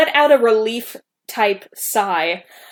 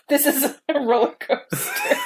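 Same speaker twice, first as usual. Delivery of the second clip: laughing. Real, not acted. Laughing at own story.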